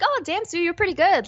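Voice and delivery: high, mildly impressed reaction; a friend pleasantly surprised at karaoke. Retold or imitated. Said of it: "you'd be like".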